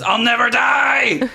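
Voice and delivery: high, slow, loud boar cry; hammed-up boar yelling; defiant shout. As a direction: bellow it out in silly defiance. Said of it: "It says".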